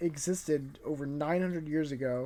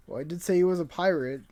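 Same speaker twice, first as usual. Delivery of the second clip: usual speaking voice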